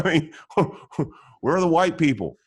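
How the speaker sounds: chuckling in disbelief at the memory; laughing. Real, not acted. Laughing at absurd courtroom memory.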